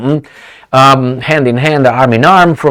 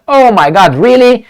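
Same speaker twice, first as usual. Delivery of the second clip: higher, louder exclamation of disbelief; surprised; 9 semitones higher, 5 dB louder than usual. Real, not acted. Mock astonishment at the phrase.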